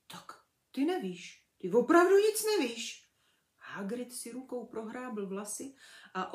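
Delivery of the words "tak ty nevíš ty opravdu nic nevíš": rising in disbelief, louder; stunned giant, growing louder; astonished. A narrator's reading. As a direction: Hagrid can't believe it. Hagrid amazed at Harry.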